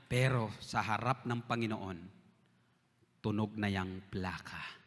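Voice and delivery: slow, low, soothing words; gentle reassurance. Retold or imitated. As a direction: comfort him slowly and softly. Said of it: "Sabi niya".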